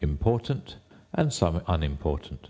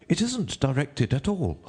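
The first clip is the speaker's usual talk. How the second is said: high, deferential courtier; 9 semitones higher than usual. A narrator's reading. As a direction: address the king in a high rabbit voice.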